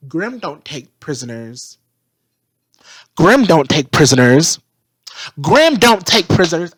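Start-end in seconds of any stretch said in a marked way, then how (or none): 3.2-6.7 mock yelling the line louder each time; mocking loud repeat. Mocking Vine's stubborn line with a loud repeat. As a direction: bellow it back to mock him. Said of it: "And, and Vine's like".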